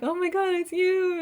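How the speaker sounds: overjoyed at a reunion; giddy squeal of it's you; excited. Pretend. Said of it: "You're like".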